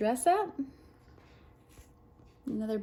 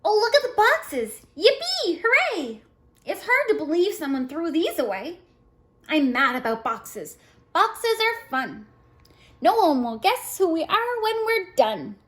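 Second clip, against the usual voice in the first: high, loud, delighted squeals; excited child; 5 semitones higher, 4 dB louder than usual. A narrator's reading. Jillian thrilled by the boxes, high excited voice. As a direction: cheer Yippee and Hooray high.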